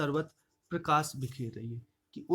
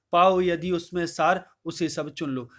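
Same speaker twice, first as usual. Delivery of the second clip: raised, measured verse recital voice; poem recitation; 5 dB louder than usual. Acted. Teacher reciting Kaikeyi's verse to the class, declaiming.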